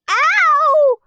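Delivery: high, loud yelp; a sharp, very loud scream; scream of pain. A narrator's reading. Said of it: "screamed Peter".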